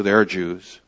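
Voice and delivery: usual speaking voice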